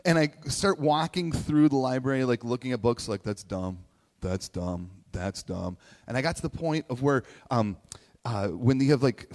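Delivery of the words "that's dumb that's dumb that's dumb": bored dismissal. Retold or imitated. Bored teen dismissing books.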